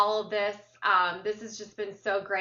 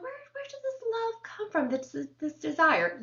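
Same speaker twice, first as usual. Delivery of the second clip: high wondering voice; 10 semitones higher than usual. Retold or imitated. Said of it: "so I was like".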